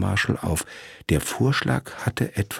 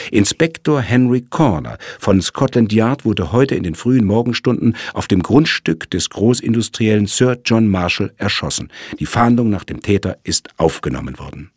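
higher, louder news-read voice; news report; 4 semitones higher, 4 dB louder than usual. Acted. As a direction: read it like a newspaper bulletin. Reads out newspaper notice.

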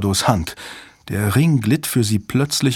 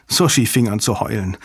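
ordinary delivery

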